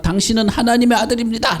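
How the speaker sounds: very high mock shriek; crying-out demon. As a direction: imitate a demon blurting it out at a high pitch. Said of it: "귀신이 막".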